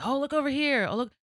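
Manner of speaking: high, mock-excited calling out; mock beckoning. False prophets drawing people over, mock-excited beckoning. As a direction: call them over with hammed-up wonder.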